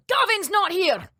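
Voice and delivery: high-pitched Scottish shopkeeper brush-off; Scottish accent. Retold or imitated. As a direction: imitate a curt Scottish shop worker. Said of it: "They just speak fucked up English".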